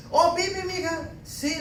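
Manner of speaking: high-pitched reply to a little daughter; acted father to child. Dad answering his toddler in bed, high parental voice.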